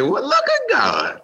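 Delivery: high, slow churchy exclaim; drawn-out mock amazement; mock praise. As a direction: throw it up like a testimony. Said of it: "So I said".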